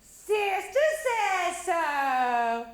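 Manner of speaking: high loud, very slow call; calling upstairs. Acted. Mama calling up to Stella, high and very slow. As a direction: draw it out up the stairs.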